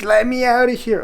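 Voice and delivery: high, loud pleading as the crated dog; mock whining dog. Owner voices his puppy crying in the crate, mock whining. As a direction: imitate a dog crying to be let out. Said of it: "when he was whining".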